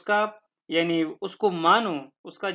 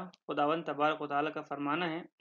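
ordinary talk, nothing marked

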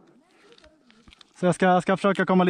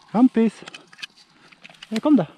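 loud, high call up the tree; calling to a cat; 6 semitones higher, 6 dB louder than usual. Real, not acted. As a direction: call the stuck cat down firmly. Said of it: "Jag pratar med katten". (högt, ljust lockrop till katten)

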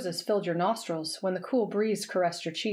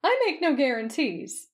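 high, playful smugness; smirking; 6 semitones higher than usual. A narrator's reading. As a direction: tease with a smirk in the voice. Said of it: "you smirked".

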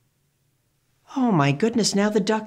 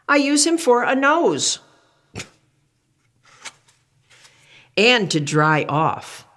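put-on reading to children; 2 semitones higher than usual. Reads picture book aloud.

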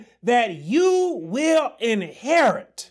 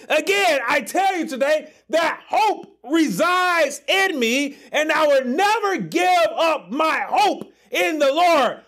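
preaching at full force; 4 semitones higher than usual. Acted. Preacher shouting hope to his congregation, fervent.

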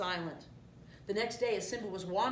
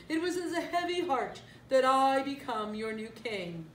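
higher, louder pompous proclamation; proclaiming new king; 5 semitones higher, 5 dB louder than usual. A narrator's reading. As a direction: declare yourself king with mock sorrow. Scar proclaims himself king.

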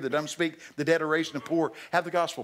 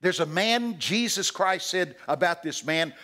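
ordinary delivery

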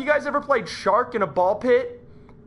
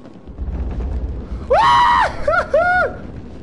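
long, very high scream breaking into whoops; terrified scream; 3 dB louder than usual. Real, not acted. Player startled in game, terrified scream. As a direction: let out a high shriek.

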